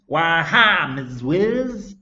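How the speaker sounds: high, loud mock-American greeting; silly American accent. A narrator's reading. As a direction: ham up the American accent cheekily. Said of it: "in a silly American accent".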